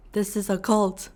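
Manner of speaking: ordinary talk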